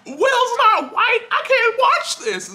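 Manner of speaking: high, mocking whiny complainer voice; mocking online complainers. Viewer mocking internet complainers about the casting, in a high whiny voice. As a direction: sneer at them with a shrill put-on whine. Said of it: "people on the internet who are like".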